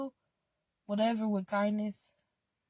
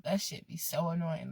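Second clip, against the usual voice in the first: ordinary talk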